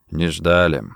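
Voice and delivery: low question with a slight smile; smiling menace. A narrator's reading. Drongo surprises Rossetti at gunpoint, smiling. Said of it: "улыбаясь, спросил Дронго".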